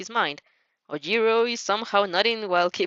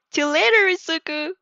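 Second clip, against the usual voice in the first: cheery goodbye; 7 semitones higher, 4 dB louder than usual. Acted. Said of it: "you wave cheerily".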